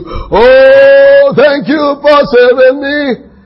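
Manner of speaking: high, slow, loud mock thanksgiving; hammed-up grateful cry. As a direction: play the overjoyed Peter, slow and loud. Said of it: "Peter would say".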